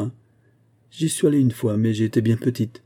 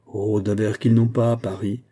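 ordinary delivery, nothing marked

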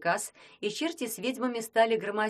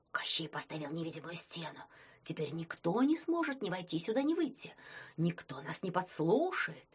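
soft, hushed murmur; approving whisper; 5 dB quieter than usual. Acted. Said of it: "одобрительный шёпот".

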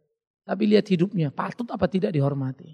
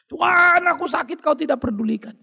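higher pitched, accusing whine at God; whiny complaining prayer; 9 semitones higher than usual. Retold or imitated. Wrong kind of prayer, a sick believer blaming God, high whine. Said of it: "Jangan pernah berdoa katakan".

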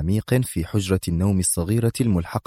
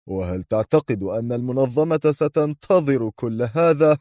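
high taunt ending in laughter; mocking question through laughter; defiant, laughing; 4 semitones higher than usual. A narrator's reading. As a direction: challenge him, amused and scornful. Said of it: "أن يقول في تحد".

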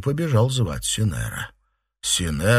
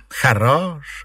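raised, scornful greeting; sardonic exclamation; 7 semitones higher, 4 dB louder than usual. A narrator's reading. Mistress greeting the guilty servant, sardonic exclamation. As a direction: exclaim it higher, with reproach. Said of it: "воскликнула Фэнзе".

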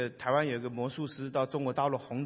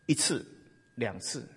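usual speaking voice

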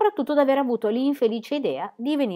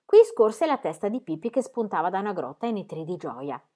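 usual speaking voice

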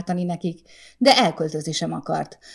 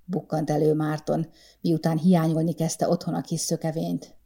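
plain reading voice

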